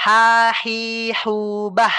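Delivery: Ha, hi, hu, bah with a clean throat h; drill of ha. Shown as a demonstration. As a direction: model each syllable Ha, hi, hu, bah. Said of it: "coba ya, ulangi ini Ana ya". (contoh lafal ha, hi, hu, bah)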